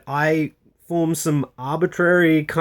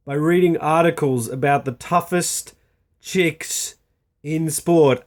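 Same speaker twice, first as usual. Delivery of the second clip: ordinary delivery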